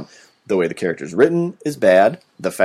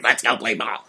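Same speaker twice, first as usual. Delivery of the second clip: softer, croaky kid impression; Froggy; 5 dB quieter than usual. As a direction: croak it like a dubbed-over child. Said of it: "like Froggy used to be like".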